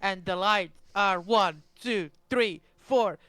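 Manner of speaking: slow, drawn-out lights countdown. Real, not acted.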